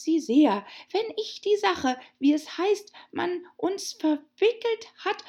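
high, loud sing-song recital; the rabbit declaiming a poem nervously; high rabbit reciting verse. Acted.

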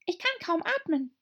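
very high tiny squeak; squeaky little dormouse. A narrator's reading. Dormouse voiced.

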